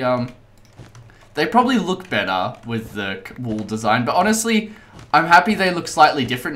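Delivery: ordinary delivery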